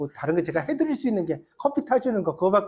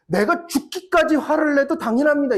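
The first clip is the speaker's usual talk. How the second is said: Jonah defiant; 8 semitones higher, 4 dB louder than usual. Retold or imitated. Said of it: "이렇게 요나가 진짜 대답했다니까요".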